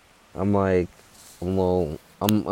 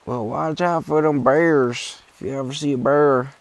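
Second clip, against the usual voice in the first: put-on old guy voice; 8 semitones higher, 4 dB louder than usual. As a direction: play the old-timer warning, then squeal run away. Old guy voiced in hiking story.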